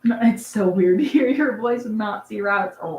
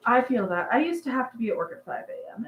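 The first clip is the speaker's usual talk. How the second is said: normal talking voice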